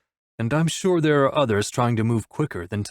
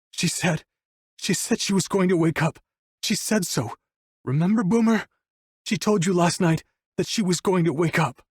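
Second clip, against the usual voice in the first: high-pitched, distraught pleading; desperate insistence; 7 semitones higher than usual. A narrator's reading. Wolf appeals to Boomer about Abigail's promise, desperate. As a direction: insist in disbelief, pitch raised throughout. Grieving man insisting she will wake.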